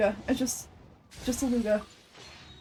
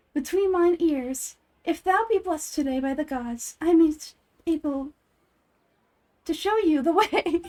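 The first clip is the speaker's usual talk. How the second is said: mystic fortune teller; 5 semitones higher, 3 dB louder than usual. Acted. Voices fortune teller in game.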